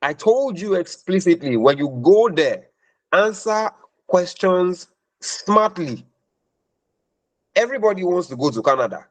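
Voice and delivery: high-pitched exasperated telling-off; retold furious scolding. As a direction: scold her like a let-down mentor. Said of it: "I was so furious".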